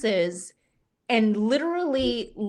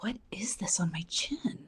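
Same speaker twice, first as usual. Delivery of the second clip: softer, whispered self-questioning; hushed puzzlement; 9 dB quieter than usual. Retold or imitated. Woman feeling her chin in the car, hushed puzzled whisper. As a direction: whisper it to yourself, retold discovery. Acts out finding a chin hair.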